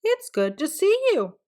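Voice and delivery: put-on high, bright kid greeting; cheery greeting. Peter plays along greeting grandpa, bright and high. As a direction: sound delighted to see him.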